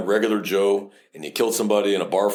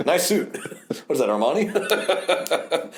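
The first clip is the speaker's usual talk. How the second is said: higher, laughing sneer; mock taunt; 7 semitones higher, much slower than usual. Officer mocking the lawyer's suit, laughing. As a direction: tease him through a laugh.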